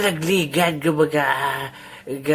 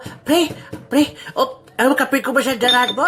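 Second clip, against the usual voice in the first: alarmed; 7 semitones higher than usual. Acted. Coffee about to spill.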